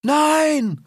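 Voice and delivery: very high cry of animals; crowd shout. A narrator's reading. Animals answer their leader, high shouted reply. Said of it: "riefen die Tiere".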